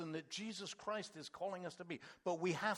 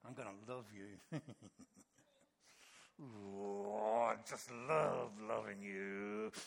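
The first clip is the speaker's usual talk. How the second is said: forced sweetness through gritted teeth; 7 semitones lower, slower than usual. Shown as a demonstration. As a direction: fake love through clenched teeth. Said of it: "Love through gritted teeth".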